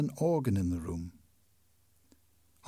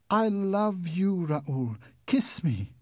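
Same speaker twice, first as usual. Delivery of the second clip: slow, high, loving plea; tender; 5 semitones higher, 4 dB louder, slower than usual. Acted. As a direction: melt into his arms. Christine embraces Raoul.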